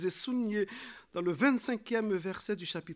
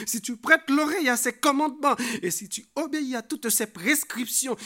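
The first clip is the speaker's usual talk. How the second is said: raised, forceful preacher's reading; emphatic scripture reading; 4 semitones higher, 8 dB louder than usual. Acted. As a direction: proclaim each condition with rising insistence. Proclaims scripture to congregation. (lecture biblique proclamée avec force)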